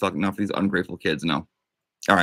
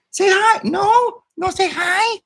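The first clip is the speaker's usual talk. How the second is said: squeaky toy voice; 6 dB louder, slower than usual. Acted.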